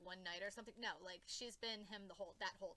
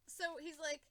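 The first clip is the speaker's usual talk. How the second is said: normal talking voice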